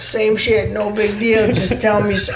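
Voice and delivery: high-pitched buddy impression; Joe Kidd accent. Buddy waves the incident away, high mimicked accent. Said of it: "That's a pretty good Joe Kidd accent, too".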